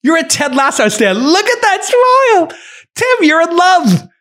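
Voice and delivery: high, sing-song teasing voice; giddy teasing. Real, not acted. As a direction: tease him about his crush. Teasing Tim Cook on video.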